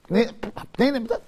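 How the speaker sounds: mipninim said slowly and softly, syllable by syllable; careful pronunciation. Shown as a demonstration. Teaching the girsa, mipninim said slowly. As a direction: spell out mipninim slowly under the breath. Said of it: "The girsa we have is mipninim".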